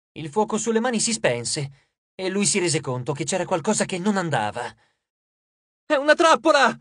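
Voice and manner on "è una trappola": very high, loud cry of warning; urgent panicked shout; shouted alarm. A narrator's reading. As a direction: yell out in sudden alarm. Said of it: "gridò".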